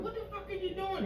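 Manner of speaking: high, indignant wife confronting him; wife catching him at the grave, shocked and high; angry wife. Retold or imitated. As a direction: catch him red-handed, voice jumping up. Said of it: "was like".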